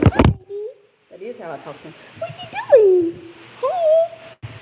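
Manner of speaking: put-on very high baby talk to the dog; puppy talk. Shown as a demonstration. Vlogger shows how she talks to her puppy, very high baby talk. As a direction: coo at the puppy. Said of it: "that's how she talked to me".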